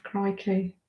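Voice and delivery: ordinary talk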